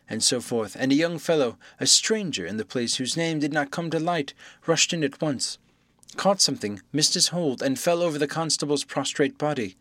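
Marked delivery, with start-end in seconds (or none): none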